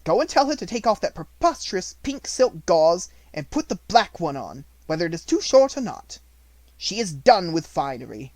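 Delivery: a cold headmistress laying down orders; stern headmistress. A narrator's reading. Headmistress ordering her sister about, stern and loud.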